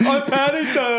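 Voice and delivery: squeaky high cartoon bear. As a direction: pitch up like a cute bear.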